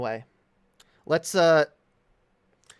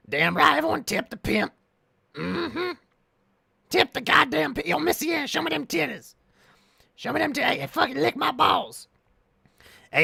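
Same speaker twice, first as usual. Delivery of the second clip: high, raspy mock pimp voice pushed way up; hammed-up pimp bragging to the chat; pimp impression; 10 semitones higher than usual. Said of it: "I do my pimp impression".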